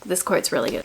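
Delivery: ordinary delivery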